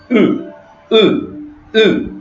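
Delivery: "E" as a vowel between I and U; dotless I. Shown as a demonstration. Teacher shows learners the letter I sans point, vowel between I and U in "E". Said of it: "là, c'est prononcé en, comme le, entre les I et le U".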